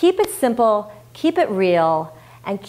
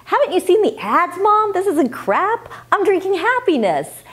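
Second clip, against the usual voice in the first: put-on higher bratty child voice, talking back; sassy kid; 10 semitones higher than usual. Child talking back to mom about soda ads, sassy. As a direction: play the kid defending the soda.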